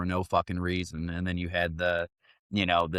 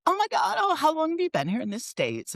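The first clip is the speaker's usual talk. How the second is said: very high-pitched gushing girl impression; mimicked perky girl. Perky girl chatting with Australian rental guy, mock high squeal. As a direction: squeal it like a perky young woman.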